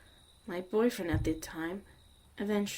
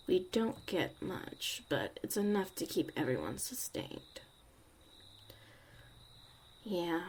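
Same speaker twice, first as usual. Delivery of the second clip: normal talking voice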